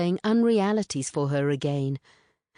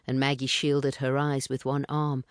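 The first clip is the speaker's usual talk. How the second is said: ordinary delivery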